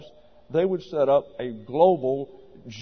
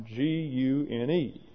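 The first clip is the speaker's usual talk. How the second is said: spelled out slowly; 5 dB quieter, slower than usual. Shown as a demonstration. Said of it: "the Greek word really for woman".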